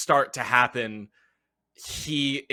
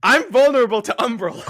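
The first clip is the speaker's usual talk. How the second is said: loud, high, rapid outburst; alarmed exclamation; 10 semitones higher, 7 dB louder, much faster than usual. Real, not acted. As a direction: blurt it out in dismay at the bad luck. Realizes own weakness to the damage.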